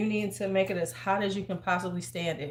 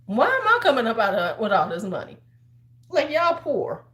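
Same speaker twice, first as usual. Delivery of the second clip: exasperated rant; 5 semitones higher, 6 dB louder than usual. Retold or imitated. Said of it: "I'm like".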